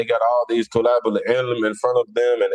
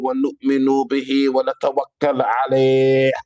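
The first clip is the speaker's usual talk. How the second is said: mock Saudi accent; 3 semitones higher than usual. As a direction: intone it like a put-on Saudi sermon opening. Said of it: "with a Saudi Wahhabi accent".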